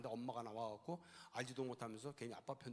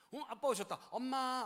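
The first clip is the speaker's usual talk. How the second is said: happy call; 11 semitones higher, 9 dB louder, slower than usual. Retold or imitated. Child calling out happily.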